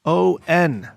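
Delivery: spelled out letter by letter, slowly; slow, deliberate spelling for the room. Shown as a demonstration. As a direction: spell each letter with space around it. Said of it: "There is a word I put in the chat".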